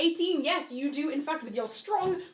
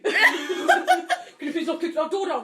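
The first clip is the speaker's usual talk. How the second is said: annoyed manager voice; 4 semitones higher, 7 dB louder, slower than usual. Pretend. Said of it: "the manager should be a Geordie".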